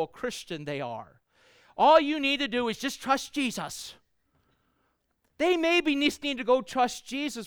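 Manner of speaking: high, loud, emphatic sermon delivery; impassioned preaching. Real, not acted. Preacher urging the congregation to be kind to strugglers, impassioned.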